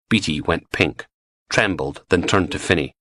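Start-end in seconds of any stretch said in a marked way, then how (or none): none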